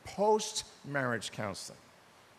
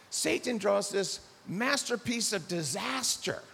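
normal talking voice

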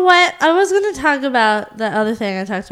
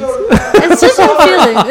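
said through a laugh, higher; 6 semitones higher, 6 dB louder, slower than usual. Real, not acted. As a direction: laugh it out, a bit slow. Repeating her segment title.

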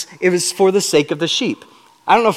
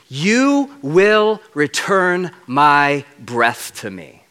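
slow reverent paraphrase; much slower than usual. Acted.

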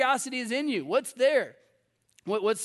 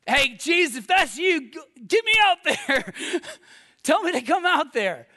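eager calling out; 7 semitones higher, 6 dB louder than usual. Acted. Acts out Peter calling to Jesus.

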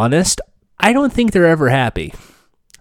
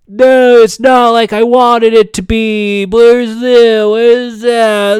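loud, high whiny complaint; whiny fan; 7 semitones higher, 8 dB louder, slower than usual. Retold or imitated. Mocking picky game show fans, loud high whine. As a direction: whine like a never-satisfied fan. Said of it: "that says exactly like it was".

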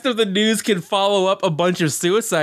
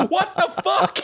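mock shock; 12 semitones higher than usual. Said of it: "And you're just like".

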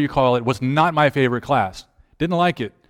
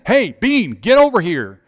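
high, raised call, urgent; yelling; 6 semitones higher, 4 dB louder, slower than usual. Retold or imitated. Captain yelling again at a distracted boy, insistent call. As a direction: yell to summon someone not listening. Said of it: "I yelled at him again".

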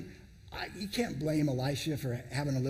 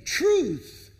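Truth rung out high and loud; proclaiming; 10 semitones higher, 8 dB louder than usual. Real, not acted. Preacher declaring truth to the congregation, loud. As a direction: shout the word Truth from the pulpit.

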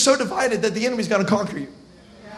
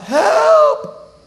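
much higher, louder, mock screaming help; crying for help; 6 dB louder, much slower than usual. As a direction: shriek it like a faked alarm. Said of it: "she begins to cry out".

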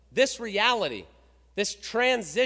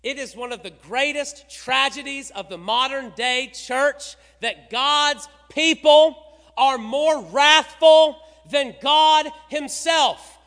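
louder, emphatic repeat; 3 semitones higher, 9 dB louder than usual. Shown as a demonstration. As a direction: drive it home louder the second time. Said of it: "Let me say it again".

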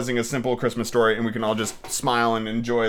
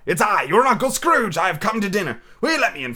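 joyful Scrooge; 7 semitones higher, 3 dB louder than usual. Acted. Scrooge come to dinner at his nephew's, joyful. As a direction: announce yourself with exuberant flourish.

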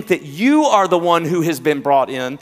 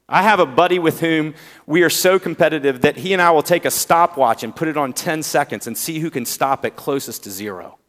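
normal talking voice

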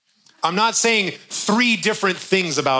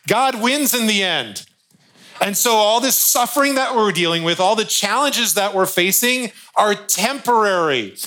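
higher, louder preaching, slowing at the end; rising sermon fervour; 6 semitones higher, 3 dB louder than usual. Real, not acted. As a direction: lift the congregation with conviction. Preacher builds to climax.

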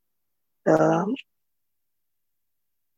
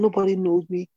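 usual speaking voice

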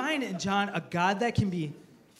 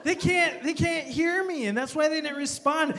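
mock yell; 9 semitones higher, 4 dB louder than usual. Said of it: "I'm like".

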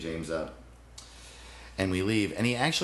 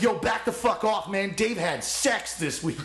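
friend defending him; 10 semitones higher than usual. Retold or imitated. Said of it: "was like".

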